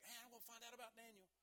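a touch of an anxious king calling out on the run; eager king. Retold or imitated. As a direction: lightly voice the king rushing to the den. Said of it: "He's like".

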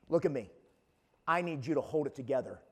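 low, quiet, firm coach; coach pulling player aside. Acted. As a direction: take the player aside, voice dropped and controlled. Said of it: "a coach pulls you aside and says this".

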